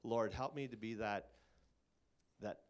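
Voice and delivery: usual speaking voice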